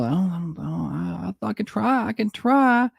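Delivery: high, pitiful mocking wife; husband imitating wife's pitiful phone call, high voiced; mock feeble wife. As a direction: play her as weak and pathetic.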